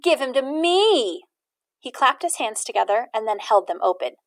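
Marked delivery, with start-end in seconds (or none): 0.0-1.2 put-on slower, teasing boy's demand. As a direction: drawl it out like a taunting boy. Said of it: "said Amos to Martin".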